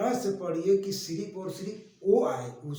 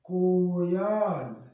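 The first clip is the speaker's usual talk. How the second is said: Coil drawn out very slowly and a bit louder; slow whole word; 5 dB louder, much slower than usual. Shown as a demonstration. Teacher reading coil whole, very slowly. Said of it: "क्या लिखा है?".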